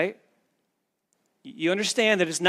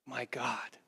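hushed whisper of anguish from the cross; whispered, lower "my God, my God"; whispered cry; 5 semitones lower, 14 dB quieter, slower than usual. Retold or imitated. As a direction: breathe it out as a whisper, spent. Jesus crying out on the cross.

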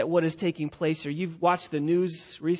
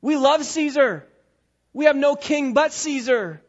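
breezy, higher-pitched mock enthusiasm; feigned loyalty; 5 semitones higher than usual. Crowd claiming loyalty to Caesar they hate, feigned enthusiasm. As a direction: shrug it off, then proclaim false devotion. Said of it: "if it means claiming loyalty to the very Caesar they hate".

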